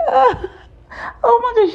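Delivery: very high imitated shock; shocked gasp. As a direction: squeal it like the whole room is stunned. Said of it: "Everybody was like".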